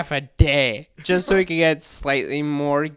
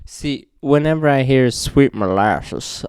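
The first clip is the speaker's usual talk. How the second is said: ordinary talk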